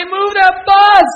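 drawn-out, high, booming command shout; Jesus. Retold or imitated. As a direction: bellow it slowly at full volume. Imitates Jesus shouting the show's command.

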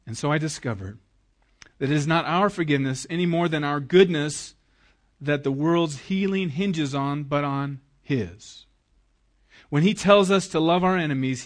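normal talking voice

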